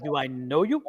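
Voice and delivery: low, curt challenge to a caller; retold irritation. Her to an unknown phone caller, irritated. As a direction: act out mild irritation at a stranger. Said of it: "'cause I was irritated".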